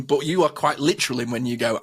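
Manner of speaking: normal talking voice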